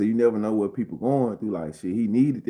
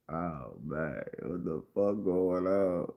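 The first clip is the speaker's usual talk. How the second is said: stunned low; 6 semitones lower, 9 dB quieter, slower than usual. Retold or imitated. His own stunned reaction.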